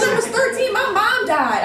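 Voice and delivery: high-pitched indignant outburst; retold going off. Worker telling a customer her past, retold indignant outburst. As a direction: re-enact blurting it out in outrage. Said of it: "I went off on him".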